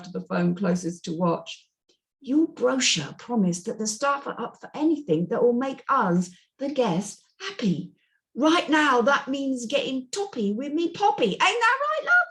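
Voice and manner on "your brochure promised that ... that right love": a higher, cheeky Cockney caller; British caller voice. Acted. Narrator voicing the Brit guest on the speakerphone, cheeky Cockney. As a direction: play the pushy Brit on the phone.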